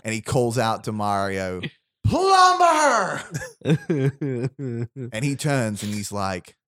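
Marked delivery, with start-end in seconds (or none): 2.1-3.2 much higher, louder cartoon villain squeal; high-pitched Koopa voice. Koopa calls out to Mario, high-pitched villain yell. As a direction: shriek the word up high like a tiny tyrant. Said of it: "and a high-pitched voice".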